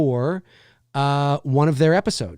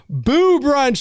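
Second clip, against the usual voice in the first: big booming announcer voice, higher; movie trailer voice; 12 semitones higher, 5 dB louder than usual. Retold or imitated. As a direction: punch the title like a promo announcer. Echoing the trailer he just played.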